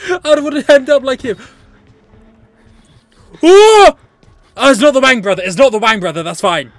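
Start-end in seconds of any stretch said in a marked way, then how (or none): 3.4-4.0 loud, high "oh" of fright; startled yelp. Real, not acted. Player startled by the game, startled yelp. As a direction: yelp at a jump scare.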